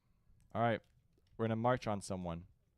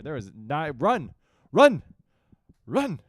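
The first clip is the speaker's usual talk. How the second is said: screaming run, higher and louder; frantic retreat order; 6 semitones higher, 17 dB louder, slower than usual. Real, not acted. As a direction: yell at them to flee. Troops fleeing, yelling at screen.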